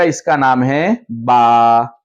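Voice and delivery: slow, drawn-out model of the letter name; slow clear naming. Shown as a demonstration. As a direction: say it slowly so children copy. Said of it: "सही तलफ्फुज बुलवाओ इनको".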